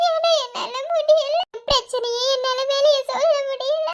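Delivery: high, crying through the words; distraught weeping plea; tearful pleading. Acted. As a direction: sob out the worry, voice cracking. Sister sick and missing husband.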